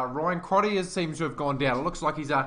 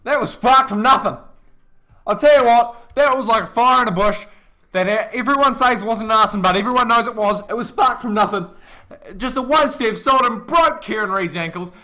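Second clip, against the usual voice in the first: excited; 5 semitones higher, 9 dB louder than usual. Real, not acted. Co-commentator hyped after try.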